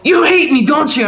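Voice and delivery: high, whiny mock accusation; mocking whiny Gabe. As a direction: sulk it out like a hurt child.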